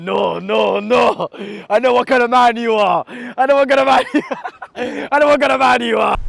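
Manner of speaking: loud, mock-stern laughing accusation; hammed-up knowing shout; imitating the driver. Vlogger mimicking the driver teasing him, loud and laughing. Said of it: "this man's going".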